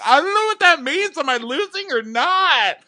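very high, loud hysterical wail; hammed-up panicked squeal; mock sobbing. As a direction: imitate a crybaby demanding an answer. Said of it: "she's sobbing".

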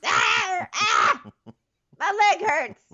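high-pitched hammed-up cries of pain; exaggerated shouted whining in bursts; mock wailing. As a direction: yelp and wail, imitating someone in a tantrum. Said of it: "She's like".

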